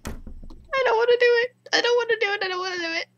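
small, high, soft whine; frightened whimper. Real, not acted. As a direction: whimper it over and over in dread. Dreads continuing horror game.

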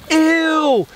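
high startled ew and scream; disgusted shriek. Real, not acted. Gross creature, startled.

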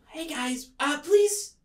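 high, soft, timid pleading; meek little mushroom begging off bullies; cornered little creature. Acted. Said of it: "they have essentially cornered Hash Brown".

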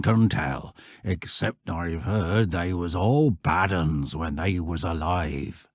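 countryman recalling dark hearsay; old shepherd. Acted.

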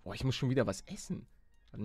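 usual speaking voice

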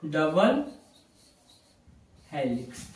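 ordinary talk

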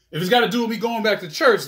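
usual speaking voice

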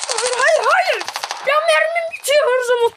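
high, loud cry of frustration; frustrated yell. Real, not acted. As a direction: yell it out as the ammo runs dry. Frustrated at running out of ammo. (yüksek sesle öfkeli bağırış)

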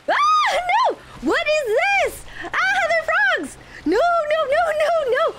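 high, loud frantic cries of no; real panic, screaming; panicked shrieking. Real, not acted. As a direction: shriek as the frogs swarm. Frogs attacking her character.

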